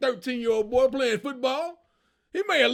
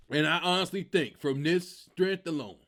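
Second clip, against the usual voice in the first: normal talking voice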